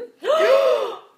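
sharp high gasp with a laugh; a laughing gasp of shock. Real, not acted. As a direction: gasp in disbelief. Shocked reaction to the story.

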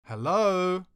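loud, high call across an empty foyer. Retold or imitated. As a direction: call hello into the big room. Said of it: "I called out".

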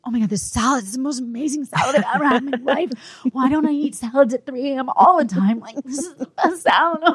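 high, fast, gushing praise; rapturous over salad. Retold or imitated. Starving new mom raving to her husband about the salad, high and fast. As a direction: gush like it's the best food ever. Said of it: "And I was like".

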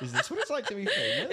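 high, fast laughing mock question. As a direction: giggle through a teasing fake brag. Teasing echo while laughing.

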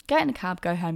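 normal talking voice

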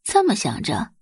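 ordinary talk, nothing marked

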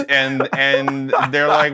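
normal talking voice

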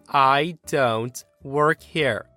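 "I don't work here" very slowly, a beat on every word; word-by-word emphasis. A narrator's reading. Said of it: "with claps on every word".

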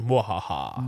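mock evil laugh, low; hammed-up villain cackle; sneering panto villain.

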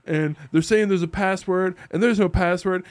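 a soldier complaining loudly at the bar; exasperated. Retold or imitated. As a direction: whine that there's no password. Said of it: "He's like".

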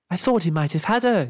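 thrilled onlooker calling out; excited yell. A narrator's reading. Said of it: "yelled one of the arm wrestling women".

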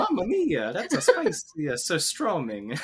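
hammy Italian voice, laughing; over-the-top Mario Italian; Italian accent. Pretend. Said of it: "It's Italian".

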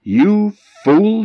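high, loud furious shriek; shrill wife's outburst. Acted. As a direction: scream it at the foolish husband. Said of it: "she was furious".

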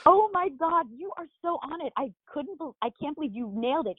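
amazed, high and then hushed. Real, not acted. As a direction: react with delighted disbelief. Amazed he spotted the wig detail.

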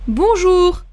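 bonjour poli, lancé plus haut; bonjour de boulangerie. Shown as a demonstration. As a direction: saluer la boulangère. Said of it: "à la boulangerie". (bonjour à la boulangère, plus aigu)

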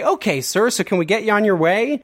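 bright wrap-up to end the call; upbeat salesman. Retold or imitated. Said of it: "they would say".

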